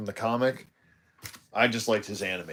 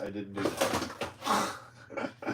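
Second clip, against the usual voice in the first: whispered aside; 3 semitones lower, 7 dB quieter, much slower than usual. Real, not acted. Embarrassed self-deprecating aside.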